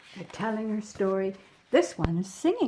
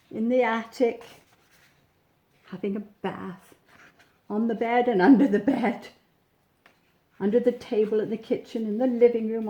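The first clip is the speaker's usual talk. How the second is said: ordinary delivery, nothing marked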